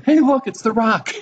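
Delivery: high, pointing out the rock with playful surprise; a hammed-up gasp of recognition; mock excited discovery. People on Judgment Day spotting the rock, mock excited.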